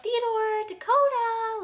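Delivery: high, loud sing-out of pet names; calling by name. Shown as a demonstration. Owner walking into the room to greet guinea pigs, high calling. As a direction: call out brightly to the pets. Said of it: "I'll walk in and be like".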